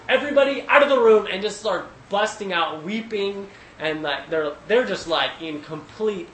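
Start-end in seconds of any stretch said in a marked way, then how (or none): 0.1-1.3 fast, much higher and louder shout; a ruler shouting everyone out before he breaks down; commanding shout. Retold or imitated. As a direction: bark the order to empty the room at once. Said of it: "and he's like".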